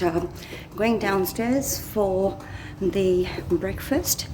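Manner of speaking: hushed voice in a public place; hotel lobby. Real, not acted. Walking through quiet hotel lobby.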